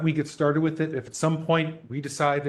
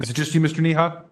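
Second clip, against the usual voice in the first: louder, calling across a bad line; to someone hard of hearing; 4 semitones higher, 6 dB louder than usual. Real, not acted. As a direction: call out so he hears. Chair calling to muted participant.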